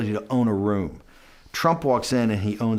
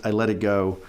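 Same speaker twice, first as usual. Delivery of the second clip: ordinary talk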